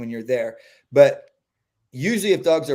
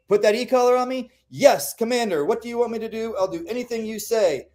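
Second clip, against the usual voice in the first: higher, eager voice; eager obedient dog; 8 semitones higher than usual. Retold or imitated. Dog with the e-collar on, eager higher voice. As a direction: play the dog as an eager little soldier. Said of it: "they're like".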